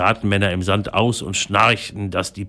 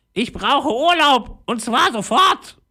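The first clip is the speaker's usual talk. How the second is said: slow, very high parrot squawk; slower than usual. A narrator's reading. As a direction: screech out each word as a demanding parrot. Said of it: "krächzte er". (hoch und langsam krächzender Papagei)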